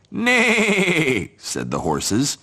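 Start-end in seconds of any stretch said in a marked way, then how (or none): 0.1-1.3 high neighing nay; whinny the word; horse neigh. Acted. Horses answer.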